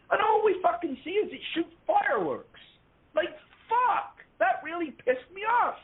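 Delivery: high, exasperated rant. Real, not acted. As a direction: vent the frustration hard. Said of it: "I'm getting into a rant".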